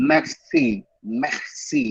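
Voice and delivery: merci with an open ai sound, held long; authentic French merci. Shown as a demonstration. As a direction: demonstrate merci like a native speaker. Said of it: "that's authentic French".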